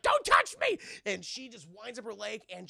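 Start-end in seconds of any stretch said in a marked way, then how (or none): 0.0-0.8 much higher, loud outraged yell; furious shout. Retold or imitated. Sonya rejecting Johnny's hug, furious shout. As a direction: snap at him to back off. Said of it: "She's like".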